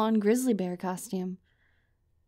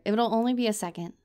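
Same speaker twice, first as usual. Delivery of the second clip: ordinary talk, nothing marked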